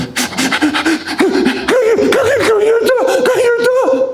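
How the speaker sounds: squealed, stretched-out mock sobbing plea; hammed-up tearful child; crying toddler. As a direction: imitate a whimpering little girl, extremely high and slow. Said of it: "Ella se me queda viendo".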